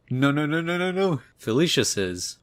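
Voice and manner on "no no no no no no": high-pitched string of panicked denials; mock panic. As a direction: imitate someone panicking, climbing higher. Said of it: "He starts panicking and goes".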